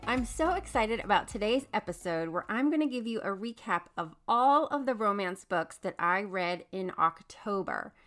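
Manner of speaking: ordinary talk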